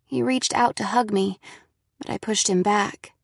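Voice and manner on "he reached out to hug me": ordinary delivery, nothing marked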